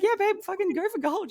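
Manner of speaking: high gleeful yes; high excited go for it; hyped encouragement. Retold or imitated. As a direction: cheer her on. Cheerful retold permission.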